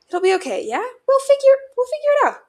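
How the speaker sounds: high, loud, desperate comfort; frantic reassurance. A narrator's reading. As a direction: reassure as if panicking yourself. Frantic comforting during a hug.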